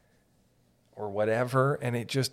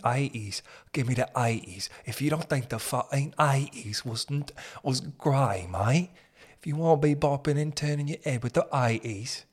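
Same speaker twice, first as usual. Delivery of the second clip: put-on London accent with dropped sounds; 3 semitones higher than usual. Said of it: "that guy does sound like he's from Lo".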